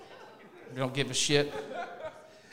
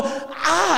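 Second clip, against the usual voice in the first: high pained ah; 10 semitones higher than usual. Retold or imitated. Wince during exam.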